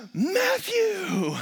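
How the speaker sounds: very high, drawn-out squeal; mock-excited teacher singling out a child; gushing teacher call. Acting teacher greeting late child.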